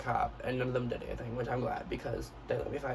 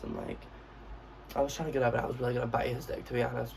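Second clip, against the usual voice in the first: ordinary talk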